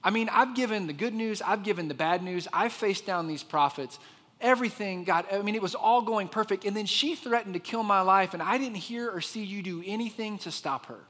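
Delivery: high-pitched, indignant pushback; acted frustrated complaint; frustrated Elijah. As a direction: argue back in exasperation, pitch up. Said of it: "that's kind of a frustrating question".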